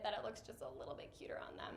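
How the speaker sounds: a shy whispered confession; whispering. Real, not acted. Confessing a cute admission.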